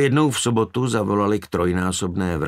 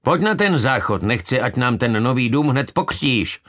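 raised, higher-pitched urging; mildly shouted plea; shouted order; 6 semitones higher, 4 dB louder than usual. A narrator's reading. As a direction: call out urgently to a child. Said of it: "zakřičel".

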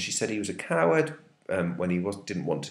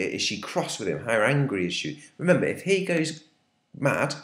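ordinary delivery

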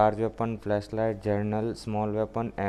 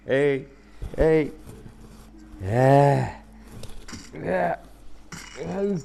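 yelling hey; 4 semitones higher, 3 dB louder, much slower than usual. Real, not acted. Yelling at a zombie in game.

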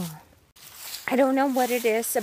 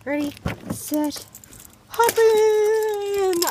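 cheery call; 9 semitones higher, 5 dB louder, slower than usual. Real, not acted. Calling dog into car.

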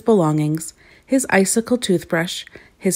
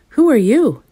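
prospector's question; 6 semitones higher, 6 dB louder than usual. A narrator's reading. Prospector questions the two strangers.

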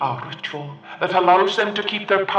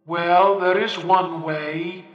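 slow and hesitant; slowly; slower than usual. Acted. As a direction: draw it out, reluctant to tell. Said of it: "he said slowly".

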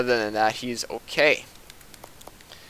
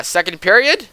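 voice crack; 6 semitones higher, 6 dB louder than usual. Real, not acted. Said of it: "Ooh, voice crack".

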